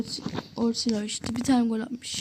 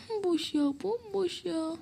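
dismayed groan; 5 semitones higher, 7 dB quieter, slower than usual. Real, not acted. The open goal is missed.

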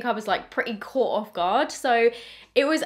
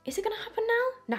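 anxious inner thought; 11 semitones higher, 4 dB quieter than usual. Retold or imitated. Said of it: "I was kind of thinking".

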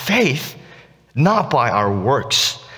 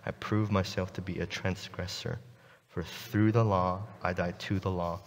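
scripture reading; 8 semitones lower, 15 dB quieter than usual. Acted. Pastor reads Galatians to the congregation, soft and solemn. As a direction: read the verses with quiet reverence.

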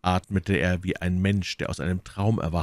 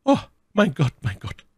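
anguished cry; 10 semitones higher, 5 dB louder than usual. A narrator's reading. Milady cries out to God before Felton, anguished. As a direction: call on heaven, then sink. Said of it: "rief My Lady".

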